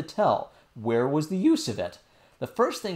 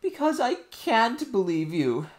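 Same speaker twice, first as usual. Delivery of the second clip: angry nurse; 10 semitones higher, 4 dB louder than usual. A narrator's reading. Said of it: "getting angry again".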